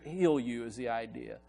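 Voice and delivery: high, loud, emphatic preacher voice; impassioned preaching. Real, not acted. Preaching with rising intensity.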